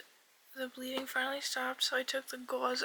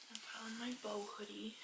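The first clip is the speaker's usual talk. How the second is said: soft unvoiced whisper; 2 semitones lower, 11 dB quieter than usual. Real, not acted. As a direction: whisper it quietly.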